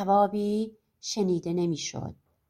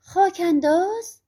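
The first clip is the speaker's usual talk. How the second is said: slow, higher puzzled question; playing dumb; 6 semitones higher, 4 dB louder, slower than usual. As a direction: feign confusion, drawn out. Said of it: "خودش رو به گیجی زد".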